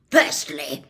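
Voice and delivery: loud growled word. A narrator's reading. Uncle growling the first rule at Harry, loud. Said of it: "growled Uncle Vernon".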